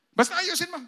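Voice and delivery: loud, high curt command; bossy order. Retold or imitated. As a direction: order them around like the boss. Boss ordering worker. (malakas na utos ng amo)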